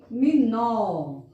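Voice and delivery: "Dominó" slowly in pieces, long open nó; stressed last syllable. Shown as a demonstration. Teacher introduces a word slowly, stressing "nó" in "Dominó". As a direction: spell out "Dominó" by sound and hold "nó". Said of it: "som mais forte é no nó".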